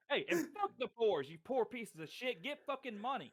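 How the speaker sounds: high, smug rich guy sneering at the poor; mock rich snob. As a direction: play a smug billionaire mocking the poor, pitched high.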